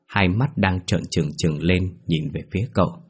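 ordinary delivery, nothing marked

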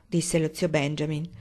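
usual speaking voice

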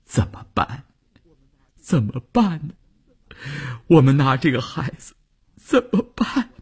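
high, tearful plea; choked with tears. Acted. Wife asks husband what to do with their child, choked with tears. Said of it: "乌云哽咽地说".

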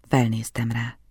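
plain reading voice

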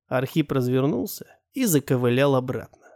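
ordinary delivery

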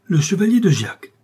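high, loud shout of surprise; astonished cry. Acted. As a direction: blurt out the name in shock. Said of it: "s'écria le comte".

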